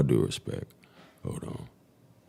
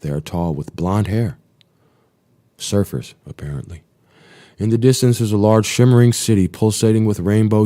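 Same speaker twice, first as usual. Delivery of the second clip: steady, slightly raised log-reading voice; diary reading; 3 semitones higher, 5 dB louder than usual. Acted. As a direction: read the flight log like a pilot's report. Reads flight log to end.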